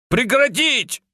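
sharp, high barked order; barked command. A narrator's reading. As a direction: bark it out to scatter them. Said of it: "Милана рявкнула".

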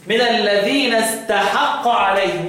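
slow recitation, "استحق" as istahaqqa; active-verb reading. Shown as a demonstration. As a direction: draw out the open a vowels in "استحق". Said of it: "الفعل مبني للفاعل".

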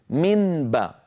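من باء with a held N before the B; clear unmerged nun. Shown as a demonstration. Teacher proves N to B is heavy, clear nun. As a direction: keep the tongue-tip N distinct in من, then باء. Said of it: "جربوا".

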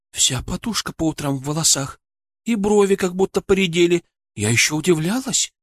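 high, wondering woman's voice; worried patient's wife. Acted. (встревоженная жена, с удивлением)

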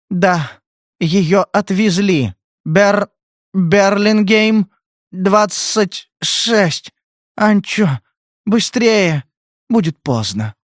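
high, slow, broken-up words; drugged girl. A narrator's reading. Drugged girl telling Spade where Bridget is, halting. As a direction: strain to get each word out.

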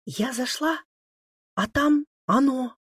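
high, halting, frightened voice; agitated and faltering, pitched well up; stammering with agitation. A narrator's reading. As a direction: stammer it out in shock. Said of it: "заикаясь от волнения, пробормотала побледневшая Маша".

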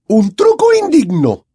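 high, loud, slow outraged cry; furious shout. A narrator's reading. McGonagall scolds the Slytherins, furious shout. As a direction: blast the words out in outrage.

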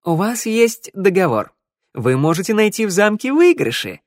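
minstrel voice. Acted. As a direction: voice him as a sly minstrel. Minstrel character voice.

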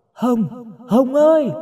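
high, echoing long-distance call. A narrator's reading. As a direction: cup hands and call into the forest. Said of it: "cả ba bắt tay làm loa gọi". (gọi vang giữa rừng)

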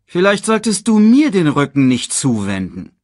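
high, loud, pointed retort; veiled threat. A narrator's reading. Scar warns the king in return, pointed threat.

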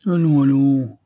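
police chief mocking Zara's resolve. A narrator's reading. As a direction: brush her off with mockery. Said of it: "d'un ton goguenard".

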